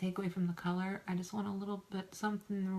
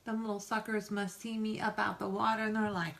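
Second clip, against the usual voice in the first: ordinary talk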